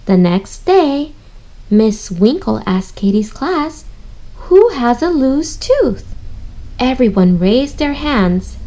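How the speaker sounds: slow, lilting storybook reading; read-aloud voice. Acted. Teacher reading to young readers, slow and sing-song. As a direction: read it slowly to little ones.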